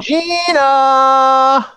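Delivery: high, loud, held-out name; long calling-out. Real, not acted. Greeting a viewer in the chat, long loud call. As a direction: holler the name and hold it.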